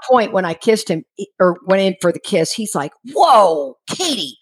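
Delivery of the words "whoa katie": mock alarmed whoa, falling away; retold taken-aback protest; startled halt. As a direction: imitate a friend stopping a kiss short. Friend halting her attempted kiss.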